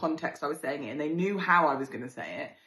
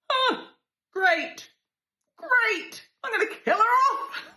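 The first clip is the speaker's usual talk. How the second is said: high, loud comic wail; mock despair; 5 dB louder, much slower than usual. Reacting to her friend's news, high comic wail.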